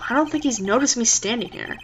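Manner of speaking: ordinary talk, nothing marked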